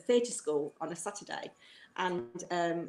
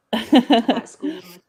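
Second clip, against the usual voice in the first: laughing; 5 semitones higher, 13 dB louder, slower than usual. Real, not acted. Laughing at herself.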